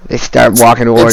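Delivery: ordinary delivery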